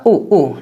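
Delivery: two short, quick oo sounds on "ऊ"; don't stretch "ऊ", keep it short. Shown as a demonstration. Teacher showing double o before k or d, short "ऊ". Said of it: "जल्दी पढ़ा जाता".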